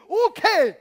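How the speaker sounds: sudden loud, high okay; booming approval. Retold or imitated. God cheering David's bold faith, loud and high. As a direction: blast out the yes like a delighted father.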